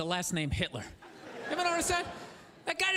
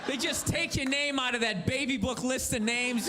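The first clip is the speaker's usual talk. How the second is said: ordinary talk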